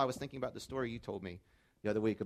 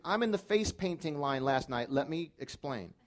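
ordinary talk

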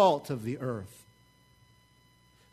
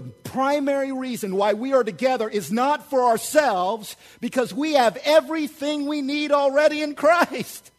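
louder, higher preaching at full force; fired-up preaching; 7 semitones higher, 5 dB louder than usual. Acted. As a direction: drive it home to the congregation. Pastor preaching to congregation.

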